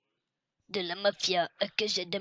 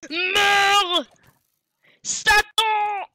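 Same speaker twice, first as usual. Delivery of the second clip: battle cry; 12 semitones higher, 10 dB louder, much slower than usual. Acted. Fighting a skeleton.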